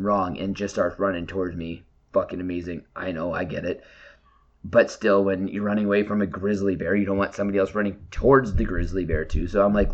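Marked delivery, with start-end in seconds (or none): none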